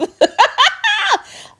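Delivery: a burst of high laughter; loud high laugh. Real, not acted. Laughs at her own story.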